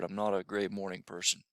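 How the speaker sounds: ordinary talk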